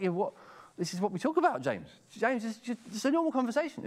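high-pitched, mock-baffled objection; protesting. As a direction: voice up high, appealing against an unfair charge. Acts out people objecting to James.

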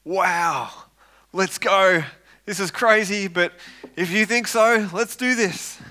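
eager but a bit nervous; nervous excitement. Acted. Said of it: "nervous excitement".

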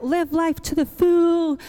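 exaggerated quote, voice lifted; mock catchphrase. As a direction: toss out a popular slogan, hammed up. Mocks a popular catchphrase.

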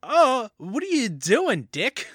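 slow, high, pleading reproach; exasperated. Acted. Podcaster scolding Dick on behalf of Tess, exasperated.

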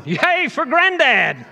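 high, loud, drawn-out whoop; gleeful cheer. Retold or imitated. Preacher cheering granddad's racy advice, gleeful whoop.

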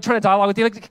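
ordinary talk, nothing marked

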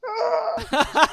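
high laughing yelp; giddy fanboy squeak; excited laughing shriek; starstruck squeal. Retold or imitated. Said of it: "I'm like".

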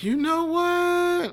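high, slow, drawn-out WHAT; mock deliberation. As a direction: stretch it out high as if reconsidering. Acting out Wayne giving in.

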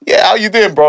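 high, upbeat hello; bright friendly greeting. Retold or imitated. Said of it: "and I'm like".

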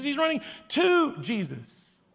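slow, leaning hard on "to" in "He's running to Jesus"; "to" held long and stressed against running away. Shown as a demonstration. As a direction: draw out "to", stretching it before "Jesus". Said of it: "He's not running away from Jesus".

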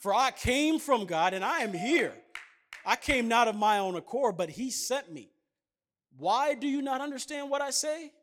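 loud, declaiming scripture reading; fervent sermon reading voice; preaching scripture. Acted. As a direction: proclaim the words of Jesus from the pulpit. Preacher reads Jesus' words aloud.

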